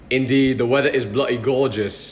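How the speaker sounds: low, put-on British accent; copied British accent. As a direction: mimic a British accent, overdoing it. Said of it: "talk in that exact same accent".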